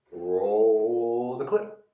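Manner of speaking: very slow, stretched-out call; drawn-out cue. Real, not acted. Host cueing the film clip, long drawn-out call. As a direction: draw it out like a showman.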